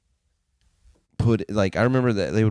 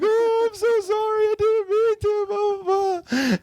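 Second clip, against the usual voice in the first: very high whiny blubbering voice, slow; mock crying apology; slower than usual. Imitating the crying buddy apologizing for the firework, high whiny sob. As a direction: ham up a sobbing friend saying sorry. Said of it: "my other buddy is sitting there crying".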